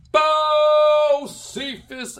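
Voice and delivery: Bo Cephas stretched long and high like a ring announcer; drawn-out announcer call. Acted. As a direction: call out the name slowly, boxing-style. Announcing host's name.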